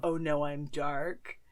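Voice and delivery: ordinary talk, nothing marked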